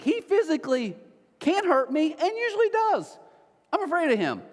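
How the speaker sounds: high, hammed-up frightened protest; scared little brother. Pastor reliving fear of older brother, high mock-scared voice. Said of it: "I was scared of my brother".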